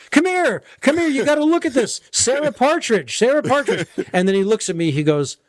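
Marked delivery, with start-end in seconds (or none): 0.1-3.9 excited calling. Retold or imitated. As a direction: wave his sister over. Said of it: "his publicist was his sister".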